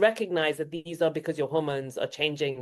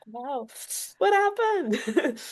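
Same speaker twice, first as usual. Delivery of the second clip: slow, much higher, stunned wow; mock astonishment; 10 semitones higher, much slower than usual. Perimenopausal woman baffled by her changes, mock astonished. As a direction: play a woman baffled by her own body. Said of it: "and you're like".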